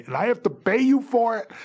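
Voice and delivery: can't believe he's asked to pay; incredulous outrage. Retold or imitated.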